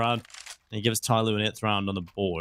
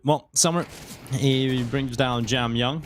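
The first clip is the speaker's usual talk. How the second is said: usual speaking voice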